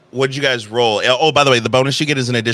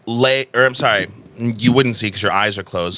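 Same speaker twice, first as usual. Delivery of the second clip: ordinary delivery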